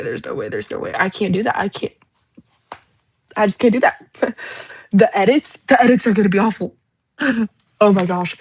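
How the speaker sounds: usual speaking voice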